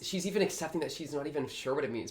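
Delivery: normal talking voice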